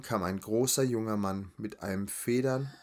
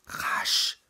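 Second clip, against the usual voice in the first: forceful stage whisper; urgent whisper; 4 dB louder than usual. A narrator's reading. Frightened woman hurries her friend through the door, sharp whisper. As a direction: hiss it out as a hurried order. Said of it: "flüsterte Lazaralen".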